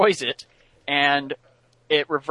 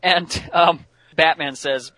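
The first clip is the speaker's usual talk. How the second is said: ordinary delivery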